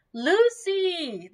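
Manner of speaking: high, drawn name call; high, falling summons. A narrator's reading. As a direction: call out for someone at a distance. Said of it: "the voices called".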